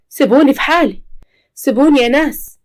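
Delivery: anguished shout; desperate cry. Acted. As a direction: cry out to be left in peace. Sara begging to be left alone.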